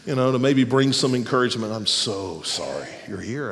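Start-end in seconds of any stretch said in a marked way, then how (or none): 1.7-3.5 low, soft, slow sympathy; tender, hushed condolence; pastoral voice. Shown as a demonstration. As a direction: console gently, like a pastor at a bedside. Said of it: "with my most pastoral voice".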